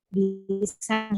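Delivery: ordinary talk, nothing marked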